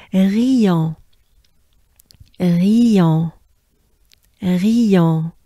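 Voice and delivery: "Rion" projected, breathy R and nasal ending; loud French Rion. Shown as a demonstration. Teacher drilling Rion loudly for the class. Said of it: "we pronounce the R".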